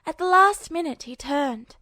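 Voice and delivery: ordinary delivery, nothing marked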